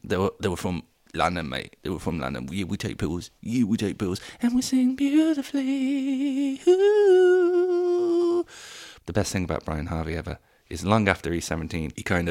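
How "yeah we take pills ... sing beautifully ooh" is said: high, crooning mock pop star; mock boy band. Mocking the boy band bragging, high croon. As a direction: croon it like a cocky pop singer. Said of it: "used to go on about taking pills".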